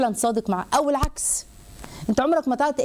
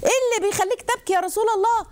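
high, eager questioning voice of a bold companion; عمر بن الخطاب voiced; 11 semitones higher than usual. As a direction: ask it high and urgent, as the fiery companion. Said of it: "انتوا عارفين سيدنا عمر بقى". (صوت عالٍ متلهف بنبرة عمر)

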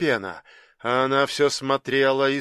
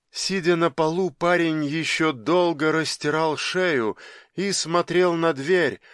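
usual speaking voice

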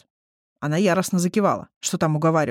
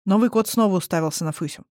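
plain reading voice

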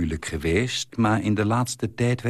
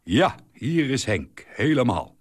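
tipsy husband on phone; 5 semitones higher than usual. Acted. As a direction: play a cheerful tipsy man on the line. Husband calls drunk on phone.

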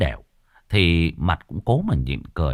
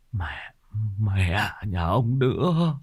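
teeth-gritted curse; 5 dB quieter, much slower than usual. Acted. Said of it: "răng nghiến ken két".

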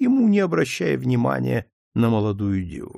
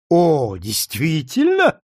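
sneering; 4 semitones higher, 4 dB louder, slower than usual. A narrator's reading. Lady sneers at girl's denial.

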